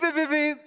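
high, mimicked alarm beeping; imitated detector beeps. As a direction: play the scanner going off.

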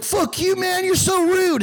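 very high, offended squeal of a critic; mock outrage. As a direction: imitate an upset critic in a squeaky voice. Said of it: "fat people that got mad at me".

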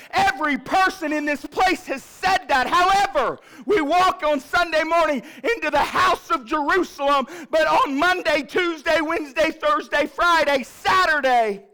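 high, loud, driving sermon cadence; rising preacher's cry; preaching at full force. Acted. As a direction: preach it out to the whole church.

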